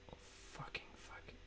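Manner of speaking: frustrated whispered curse; whispered swearing. Real, not acted. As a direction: hiss the curse under your breath. Page still loading, frustrated.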